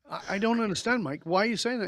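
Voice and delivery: high, stammered protest; acted bafflement. Man protesting to his mate Mike, baffled.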